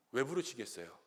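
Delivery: ordinary talk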